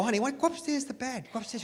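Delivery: soft, fading coax; husband coaxing tired wife upstairs. Retold or imitated. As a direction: ease her toward bed.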